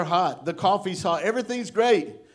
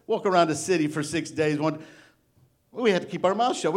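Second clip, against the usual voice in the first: normal talking voice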